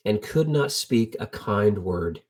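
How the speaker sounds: usual speaking voice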